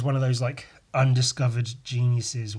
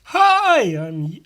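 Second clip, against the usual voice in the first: greeting voice; 8 semitones higher, 8 dB louder, slower than usual. Pretend. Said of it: "it's not working".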